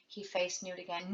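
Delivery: plain reading voice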